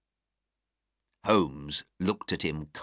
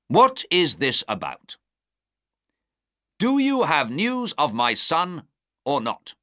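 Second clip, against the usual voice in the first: angry; 8 semitones higher, 4 dB louder than usual. A narrator's reading. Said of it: "the Duke said angrily".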